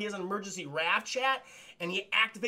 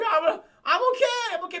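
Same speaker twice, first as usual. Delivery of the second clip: high, loud breathless okays; shaky reassurance; 12 semitones higher, 8 dB louder than usual. Retold or imitated. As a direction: insist you're fine after a crash. Dazed after crash.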